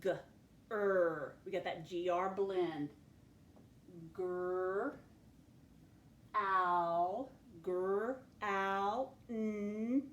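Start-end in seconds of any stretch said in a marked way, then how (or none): 0.0-1.4 "G, er" as separate letter sounds; a short "G", then a held "er"; sounding out. Shown as a demonstration. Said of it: "work out the word". 4.1-10.0 "Gr, ow, n" as isolated, held sounds; sounding out. Shown as a demonstration. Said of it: "work out the word".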